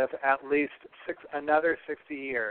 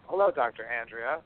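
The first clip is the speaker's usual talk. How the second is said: ordinary talk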